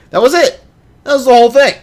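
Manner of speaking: high, loud, disbelieving; can you believe it; incredulous. Real, not acted. Host amazed by the fence-painting ad, incredulous.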